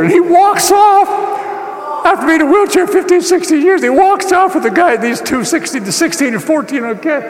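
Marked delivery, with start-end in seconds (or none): 0.0-5.0 high-pitched, rushing, near-shouted amazement; excited amazement. Real, not acted. Preacher tells congregation the boy walks off, excited amazement. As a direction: shout out the miracle, pitched right up. Climax of the healing story.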